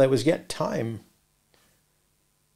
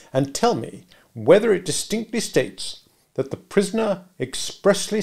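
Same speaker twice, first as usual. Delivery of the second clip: slow, higher, deliberate pressing; walk the witness through it word by word; insistent lawyer; bullying cross-examiner; 7 semitones higher, 3 dB louder, slower than usual. Acted. Stranger cross-examining Mister Wopsle.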